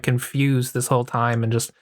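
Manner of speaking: ordinary talk, nothing marked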